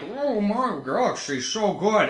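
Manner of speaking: high, gushing imitation of fans; mock fans. Imitating fans of a game he hated, mock gushing. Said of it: "They're like".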